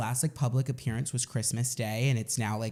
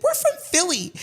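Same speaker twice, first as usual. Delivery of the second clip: mock proud retort; 6 dB louder than usual. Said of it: "about to be like".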